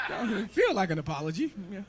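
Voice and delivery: ordinary talk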